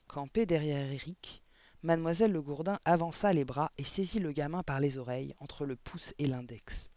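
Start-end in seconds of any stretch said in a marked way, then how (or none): none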